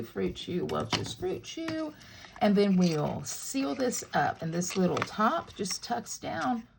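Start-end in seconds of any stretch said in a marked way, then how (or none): 0.7-1.9 slow, higher, playful sing-song on the brand name. Pretend. Crafter dropping pretend candy in the box, playful sing-song. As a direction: savour the name like a treat.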